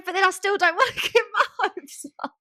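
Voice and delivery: high, loud laughing words. Real, not acted. Admitting she still doesn't want to give up hope, laughing. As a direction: laugh through the line.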